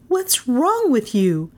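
high, slow exasperated question; demanding. A narrator's reading. Melissa baffled by urgent Carstairs, demanding. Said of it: "Melissa demanded".

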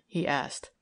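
ordinary delivery

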